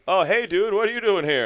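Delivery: mock thrilled friend; excited greeting. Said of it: "Jake's like".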